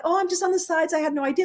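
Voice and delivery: high, feigned innocent excuse; sing-song fake innocence; mock innocence. Mocking someone's excuse.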